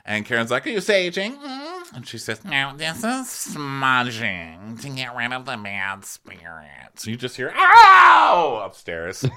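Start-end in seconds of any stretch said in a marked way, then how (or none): 0.7-2.0 high put-on query ending in a hum; mock Karen. Host as Karen asking Mia, mock nosy. 2.4-7.1 slow, drawn-out put-on voice; mock Mia. Host as Mia explaining smudging, mock languid. 7.5-8.6 high, very loud comic howl; mock pained yell. Candace yelling from upstairs, hammed-up howl.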